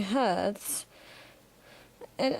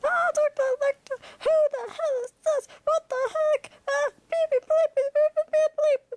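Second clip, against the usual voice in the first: screaming in very high falsetto; rapid, shrill panicked squeal; Clarice; 4 dB louder, faster than usual. Acted. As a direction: shriek it fast in a put-on high voice. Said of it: "Clarice in there screaming".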